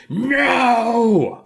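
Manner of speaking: high, loud drawn-out cry of protest; hammed-up wail of defeat; upset mock wail. Reflection watching Max escape, hammed-up upset wail. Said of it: "his reflection is getting upset".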